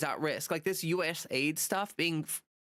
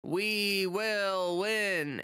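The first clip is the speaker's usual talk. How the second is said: very slow, drawn-out mock shout with no emotion; Schumer's flat shout; 3 semitones higher, much slower than usual. Schumer rallying the crowd, slow, emotionless mock shout. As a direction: drag each word out, no feeling behind it. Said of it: "this is literally the tone of voice that he's shouting this in".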